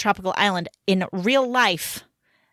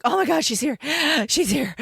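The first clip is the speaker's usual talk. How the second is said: relieved gasping; 5 semitones higher than usual. Retold or imitated. Finding her lost daughter.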